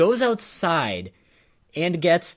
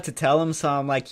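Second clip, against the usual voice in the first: ordinary talk, nothing marked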